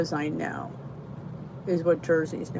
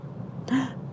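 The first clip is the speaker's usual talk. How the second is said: gasp; 5 semitones higher, 7 dB quieter than usual. Real, not acted. Reacting to a food in chat.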